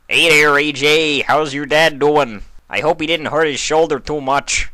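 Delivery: a big neighbor welcoming a friend; hearty stallion. Acted. Narrator voices big stallion Carlton.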